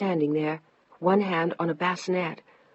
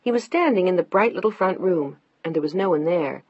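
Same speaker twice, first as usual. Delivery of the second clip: ordinary delivery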